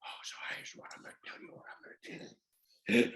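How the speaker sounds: barely audible whispered acting voice; Clint Eastwood. Imitating the star switching into character on action, whispered voice. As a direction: sink into a hushed, quick whisper. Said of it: "We were talking like this".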